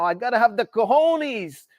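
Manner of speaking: put-on New York accent tried on after a long talk with Gerald; bad New York accent. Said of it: "That was probably a really bad impression".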